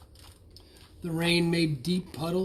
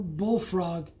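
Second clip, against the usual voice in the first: ordinary delivery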